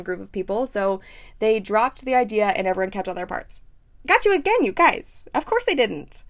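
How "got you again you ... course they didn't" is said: playful gotcha, higher and louder; teasing. Real, not acted. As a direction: tease the audience gleefully. Teasing viewers after a fake-out.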